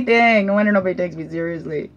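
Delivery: usual speaking voice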